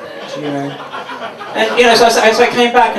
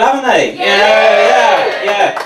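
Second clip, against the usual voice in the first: mock rugby cheer; 4 semitones higher, much slower than usual. Real, not acted. Cheering about Ireland rugby.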